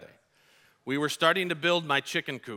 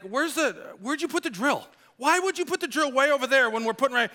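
high, hurried, exasperated dad; re-enacted freak-out; 9 semitones higher, faster than usual. Dad freaking out on sons about the drill, exasperated. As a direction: fire off accusing questions in a high voice. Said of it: "I'm freaking out on them".

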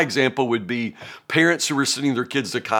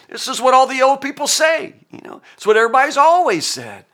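pitched-up voice of a know-it-all friend; mocking old sage; 10 semitones higher, 5 dB louder than usual. Preacher mocking Eliphaz to congregation, pitched-up sneering voice. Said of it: "Eliphaz just said".

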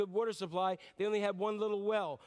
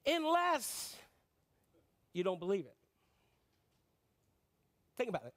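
ordinary talk, nothing marked

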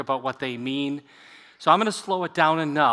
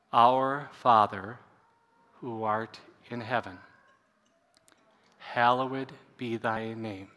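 slow, hushed spoken prayer, drifting softer; 4 semitones lower, 4 dB quieter, slower than usual. Acted. Pastor leading the congregation in the Lord's Prayer, slow and hushed. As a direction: lead the prayer slowly and softly.

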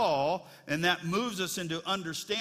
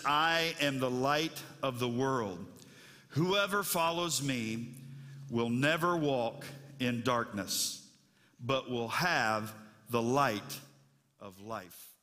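solemn scripture reading; 5 semitones lower, slower than usual. Acted.

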